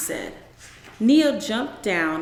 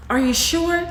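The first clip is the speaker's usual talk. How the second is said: high, loud anxious call down the hall; 9 semitones higher, 5 dB louder than usual. Acted. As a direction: call back from the hallway, worried. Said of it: "she called back".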